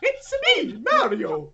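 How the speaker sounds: high, loud cartoon Italian accent; Mario. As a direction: squeal it in a hammed-up Italian accent. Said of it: "It's a stereotypical Italian accent".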